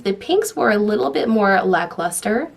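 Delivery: ordinary delivery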